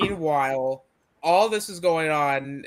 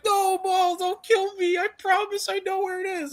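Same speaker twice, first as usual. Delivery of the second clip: very high falsetto plea; hammed-up squeal, pleading for your life; squeaky mock begging. Voicing Vario begging for life.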